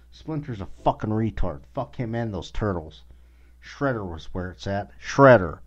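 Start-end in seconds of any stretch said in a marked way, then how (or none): 5.0-5.6 loud shout of the one-word name. Real, not acted. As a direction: yell it out like a fan. Cheering for Shredder.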